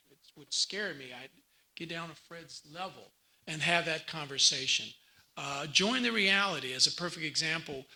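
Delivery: ordinary talk, nothing marked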